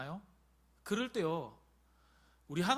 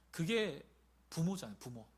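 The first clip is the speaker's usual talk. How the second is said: ordinary talk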